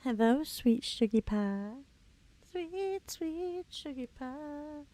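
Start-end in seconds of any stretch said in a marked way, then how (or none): 2.5-4.8 high, soft and slow cooing. Acted. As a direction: coo it softly like to a pet. Coos at in-game pet.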